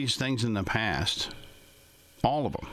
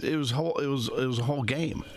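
normal talking voice

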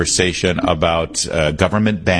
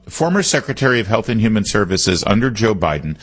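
usual speaking voice

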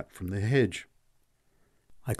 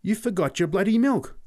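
much higher, louder amazed cry; astonished; 11 semitones higher, 5 dB louder than usual. Acted. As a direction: blurt out the discovery. Boy noticing missing milk.

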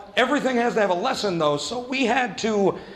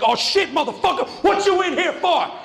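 mock excited inmate; 10 semitones higher than usual. Inmate jeering at the crying new kid, high and gleeful. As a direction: leap on him like a shark on blood. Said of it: "It's like".

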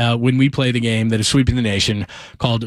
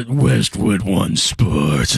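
very slow, low, booming station ID; deep announcer voice; 6 semitones lower, much slower than usual. Acted. Host imitating his old colleague's liner, deep announcer voice. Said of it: "deepest voice".

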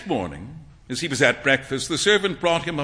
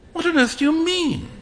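astonished exclaim; 12 semitones higher than usual. A narrator's reading. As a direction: voice jumps high in disbelief. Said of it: "exclaimed Hughie".

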